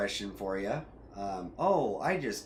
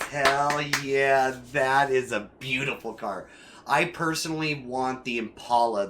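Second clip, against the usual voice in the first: loud, excited car-lover's outburst; getting loud; 3 semitones higher, 6 dB louder than usual. Real, not acted. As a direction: get carried away raving about a favourite car, loudly. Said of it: "I'm getting a little loud".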